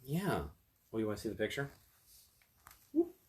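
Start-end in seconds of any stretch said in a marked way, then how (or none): none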